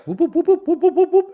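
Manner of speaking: high, loud motorcade whoops; imitated siren whoops. Comic imitating the motorcade he heard from his room, high siren whoops. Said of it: "I just hear, like".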